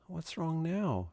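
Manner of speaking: gentle, hushed, slow question; soft and slow. A narrator's reading. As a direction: ask softly in the dark. Franklin gently asks.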